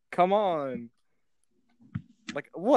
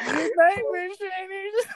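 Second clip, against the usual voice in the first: much higher, crying-laughing imitation of the farewell; higher teary mock sign-off; mock crying goodbye; 4 dB louder, slower than usual. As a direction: whimper the goodbye through giggles. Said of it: "She's like".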